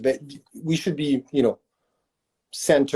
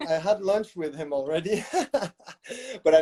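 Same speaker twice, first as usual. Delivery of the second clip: a laugh breaking into the words; amused, telling he already lunched with him; 4 semitones higher than usual. Real, not acted. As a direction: say it through a chuckle. Laughs while telling lunch story.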